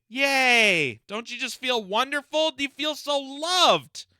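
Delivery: mock-gushing, pitched high; sarcastic cheer. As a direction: sarcastically celebrate a paltry gift. Mock celebration of the government payout.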